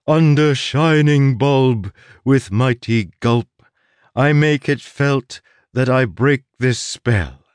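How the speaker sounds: slow, hammed-up spell chant; mock incantation. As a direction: intone it like a grand ritual. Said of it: "I should play the part".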